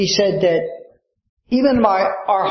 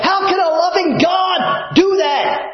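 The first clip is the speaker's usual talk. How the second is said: objector's protest; 11 semitones higher than usual. Pretend.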